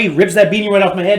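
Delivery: ordinary talk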